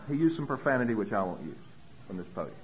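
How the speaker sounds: ordinary talk, nothing marked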